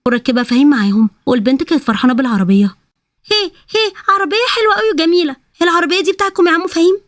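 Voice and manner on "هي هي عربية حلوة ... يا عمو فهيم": put-on high, excited little girl squealing over the car. Little girl thrilled by the car, high childlike voice. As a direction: play a delighted small child, pitched way up.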